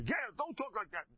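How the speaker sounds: high-pitched acted scolding; mock rebuke. Rabbi rehearsing a rebuke to his gabbai, mock outraged. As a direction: scold him as if scandalized.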